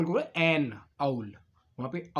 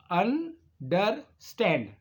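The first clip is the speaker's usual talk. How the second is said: Understand slowly in three separate beats; split into syllables; 3 semitones higher, much slower than usual. Shown as a demonstration. Teacher giving another example, understand split slowly into three. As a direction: break understand into अन, डर, stand. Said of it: "अन एक syllable आ गया".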